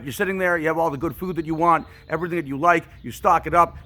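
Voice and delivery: ordinary delivery, nothing marked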